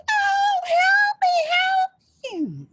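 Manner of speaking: high, slow crying plea to be let go; a trapped little devil begging, voice cracking high and loud; pleading little devil. Acted. Trapped little devil begs the blacksmith, high crying plea.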